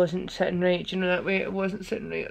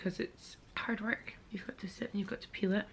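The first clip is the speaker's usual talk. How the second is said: hushed whispered chat; 9 dB quieter than usual. Real, not acted. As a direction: whisper close to the mic. Quiet hotel room chat.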